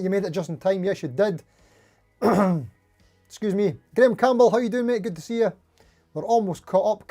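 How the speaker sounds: ordinary talk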